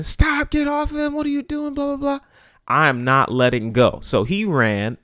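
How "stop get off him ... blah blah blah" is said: much higher, squeaky mock kid shouting; high-pitched mock of a kid fussing at him. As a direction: mimic a frantic kid yelling stop. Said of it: "he started fussing".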